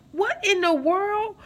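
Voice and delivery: high, loud and slow outraged disbelief; what in the world, said high, loud and slow. Real, not acted. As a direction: let the shock rise way up. Reacting to the abuser's threat.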